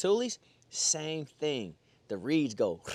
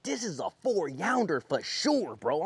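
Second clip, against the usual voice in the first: high, drawn-out hyped boast; pumped up; 4 semitones higher, slower than usual. Retold or imitated. Angler reliving landing the big fish, pumped up. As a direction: retell it hyped, stretching the words out. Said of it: "I'm pumped".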